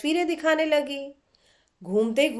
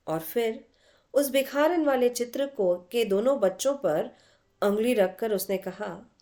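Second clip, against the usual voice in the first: ordinary delivery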